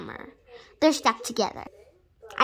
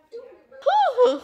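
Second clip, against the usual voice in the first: ordinary talk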